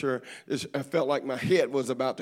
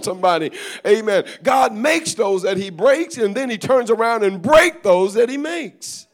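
fervent preaching; 4 semitones higher, 7 dB louder than usual. Real, not acted. Preacher telling the church God uses broken people, fervent, building. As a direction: build to full-force preaching, stretching the key phrases.

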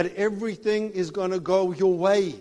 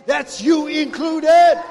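much higher, loud, slow shout; shouted exhortation; 9 semitones higher, 9 dB louder, much slower than usual. Real, not acted. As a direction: drive it home to the crowd. Preacher exhorting congregation.

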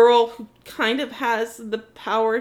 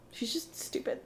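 whispered, laughing jab; breathy laughing whisper; muttered aside; 2 semitones lower, 16 dB quieter than usual. Real, not acted. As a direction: toss it off under your breath.

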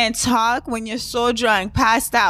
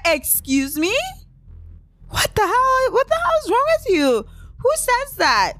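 much higher, incredulous outrage; outraged disbelief; 12 semitones higher than usual. Retold or imitated. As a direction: shriek it up in shocked disgust. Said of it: "I was like".

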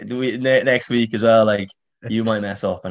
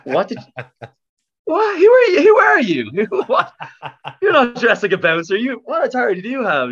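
outraged disbelief; 14 semitones higher than usual. Retold or imitated. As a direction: squeak it out in laughing disbelief at the bouncer. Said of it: "It's like".

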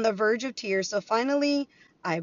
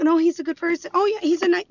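higher, louder sing-song imitation; mock family defense; 8 semitones higher, 5 dB louder, faster than usual. Mimicking his family defending him, mocking. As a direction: parrot their naive praise. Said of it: "But they're like".

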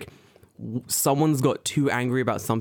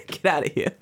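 mock cop; 5 semitones higher, 5 dB louder than usual. Playing gatekeeper cop.

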